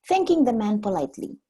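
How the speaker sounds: plain reading voice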